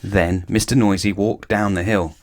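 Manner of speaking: ordinary delivery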